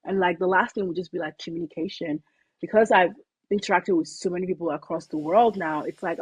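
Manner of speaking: normal talking voice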